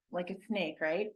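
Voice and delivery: ordinary talk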